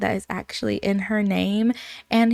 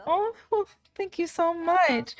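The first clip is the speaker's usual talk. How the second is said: touched thanks; 8 semitones higher, 4 dB quieter than usual. Acted. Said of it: "And she's like".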